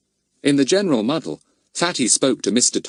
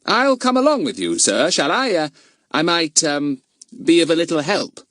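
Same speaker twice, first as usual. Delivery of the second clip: much higher, coaxing and hesitant; Fatty persuading the inspector; persuasive boy; 8 semitones higher than usual. Acted. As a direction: wheedle your way in politely. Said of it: "spoke persuasively".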